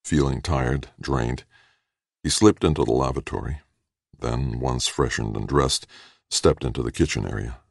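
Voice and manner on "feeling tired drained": ordinary delivery, nothing marked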